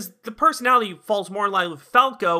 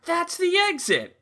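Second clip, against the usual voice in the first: cheery shopkeeper; 11 semitones higher than usual. Retold or imitated. Retold shopkeeper pointing out exit.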